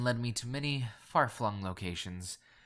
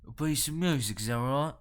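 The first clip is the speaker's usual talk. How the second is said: louder, throaty Cockney questioning; guttural nasal Cockney; 4 semitones higher, 5 dB louder than usual. A narrator's reading. Londoner probing a collector, in guttural Cockney. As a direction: ask it in rough nasal Cockney. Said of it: "guttural yet nasal Cockney accent".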